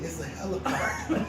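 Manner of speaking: soft hushed words, nearly breath; whispered. A narrator's reading. Boy whispering to the boss about the helicopter. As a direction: whisper it like a hiding child. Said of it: "the little boy whispering".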